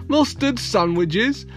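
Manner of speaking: high, slow, tickled echo; chuckling. A narrator's reading. Husband repeats his wife's plan, chuckling. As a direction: echo it slowly with delighted amusement. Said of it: "chuckled Mister Grinling".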